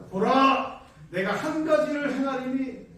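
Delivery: loud, commanding declaration; voice of God. Acted. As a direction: proclaim as God answering. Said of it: "이렇게 대답을 하세요".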